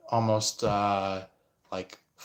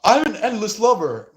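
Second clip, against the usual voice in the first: high, loud grand declaration; mock boast; 11 semitones higher, 7 dB louder than usual. Intruder boasting about being a lover, high and hammed-up. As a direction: puff it up like a self-styled lover. Mocking boastful third party.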